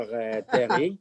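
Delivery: Terry said in a French accent; lightly French-accented greeting. Shown as a demonstration. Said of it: "in the French accent".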